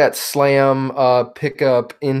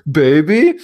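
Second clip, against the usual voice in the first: Sloth voice; 3 semitones higher, 6 dB louder than usual. Retold or imitated. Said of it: "he just talks like Sloth from the Goonies".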